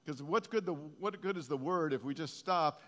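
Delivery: loud, high, driving preacher's voice; full-force preaching. Acted. Preacher firing up the congregation, loud and high. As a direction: preach it out with fire.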